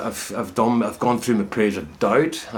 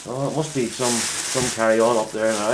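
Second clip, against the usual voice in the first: normal talking voice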